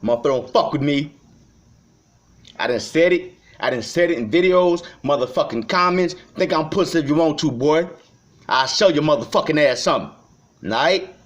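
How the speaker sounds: going off on the haters, voice up; angry rant. Real, not acted. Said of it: "I'm going off and melting down".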